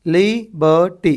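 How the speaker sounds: Liberty slowly in three separate beats; syllable by syllable. Shown as a demonstration. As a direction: break liberty into li, ber, ty. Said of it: "कितने अपने syllables".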